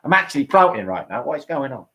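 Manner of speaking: rough attempt at a Cockney accent; Lee, Cockney accent. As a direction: imitate a friend with broad Cockney vowels. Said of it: "He talks like a Cockney".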